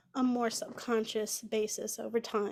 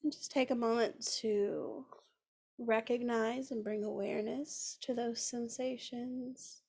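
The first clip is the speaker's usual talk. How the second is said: unhurried, soothing guide into the breath; guided meditation. Acted.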